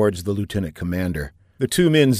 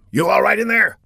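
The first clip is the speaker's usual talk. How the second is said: high, louder call up to the cockpit; 12 semitones higher, 4 dB louder than usual. Acted. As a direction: check on him through the glass. Calling up to cockpit.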